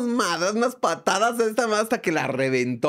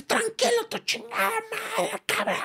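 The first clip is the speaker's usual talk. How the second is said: high put-on thug voice, dropping softer at the end; mock robber threat; 4 dB quieter than usual. Narrator mimics the robber's insult in a high put-on voice. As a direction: sneer it like a robber, high and mocking. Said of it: "Y entonces me dice".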